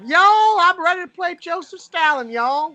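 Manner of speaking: high, drawn-out mock Southern Georgia accent; Georgian accent, meaning US South. Riffing on an actor readying to play Stalin, mock Southern Georgia accent. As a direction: ham up a slow, high-pitched Georgia drawl. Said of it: "I'll do the Georgian accent".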